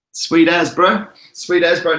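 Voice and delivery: high, loud put-on Kiwi accent; mock New Zealand twang. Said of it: "is New Zealand".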